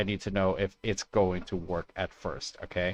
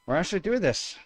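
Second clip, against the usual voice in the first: excited; 10 semitones higher, faster than usual. Real, not acted. Repeat higher.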